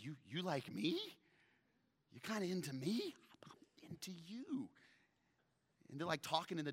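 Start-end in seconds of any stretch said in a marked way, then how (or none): 0.0-4.7 flirty Boaz. Retold or imitated. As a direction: play him smitten, drop to a secret whisper. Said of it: "He's like".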